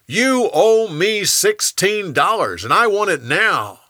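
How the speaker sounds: bully him for the money; angry demand. Acted. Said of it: "grabbed him and started choking him".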